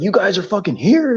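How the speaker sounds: high, startled mock disbelief; tow guy's disbelief. Tow guy finding them at the haunted house, startled. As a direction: pitch it up like you can't believe they're there. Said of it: "he starts freaking out".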